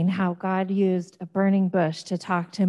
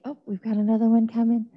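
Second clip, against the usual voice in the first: normal talking voice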